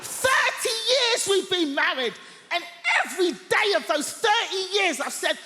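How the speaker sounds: mock fed-up husband scolding; husband ranting at his nosy wife; grumpy old husband. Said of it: "he's behind that newspaper going".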